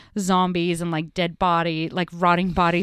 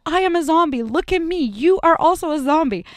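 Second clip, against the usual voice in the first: higher emphatic telling; urgent high voice; insistent explaining; 9 semitones higher, 4 dB louder than usual. Retold or imitated. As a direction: friend showing she's a zombie too. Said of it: "Caitlin's like".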